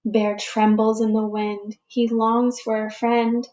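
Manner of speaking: put-on slow, expressive read-aloud for young children; storybook reading. A narrator's reading. Teacher reading a picture book to the class, gentle storytelling. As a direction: read it like story time for little ones.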